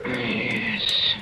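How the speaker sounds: breathless whispered yes; pained whisper. Acted. Poisoned husband admitting the pain, whispered. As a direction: force it out through pain.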